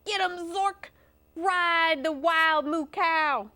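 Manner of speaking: high rodeo cheer; stretched-out, high cry of encouragement; cheering crowd. Acted. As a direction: egg the rider on from the stands.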